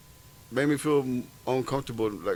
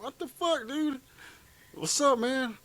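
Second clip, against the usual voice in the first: high-pitched mock bewilderment; retold baffled protest; 12 semitones higher, slower than usual. Storyteller replaying his reaction to the sergeant's punches, high baffled protest.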